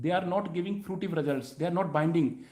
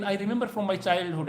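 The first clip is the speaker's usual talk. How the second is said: ordinary delivery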